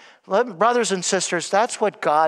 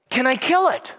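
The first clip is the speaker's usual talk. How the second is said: eager angel; 6 semitones higher than usual. Retold or imitated. Angel asks again.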